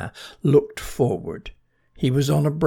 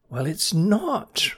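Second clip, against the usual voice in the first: retort; 7 semitones higher, slower than usual. Acted. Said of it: "retorted Bernie".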